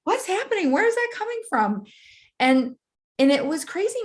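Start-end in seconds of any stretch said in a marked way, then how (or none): 0.0-1.8 much higher, alarmed questions; startled disbelief; bewildered. Retold or imitated. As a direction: pitch up in confused alarm. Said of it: "I was like".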